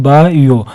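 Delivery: Bio said slowly as बा, यो; split into syllables. Shown as a demonstration. Teacher counting sounds in a word, bio slowly split as बा-यो. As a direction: break bio into two slow beats. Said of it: "दो, दो sounds है".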